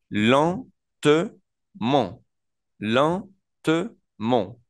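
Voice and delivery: drawn-out "lentement" with nasal A sounds; slow French demo. Shown as a demonstration. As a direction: stretch "lentement" very slowly, nasal en. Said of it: "Entonces decimos lent". ("lentement" muy lento, con A nasal)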